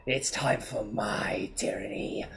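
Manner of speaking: slow sinister whisper; villainous whisper. Acted. Frost Lord whispering his dark ambition to his mother. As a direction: breathe the threat. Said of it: "He goes".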